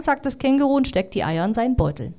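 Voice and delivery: usual speaking voice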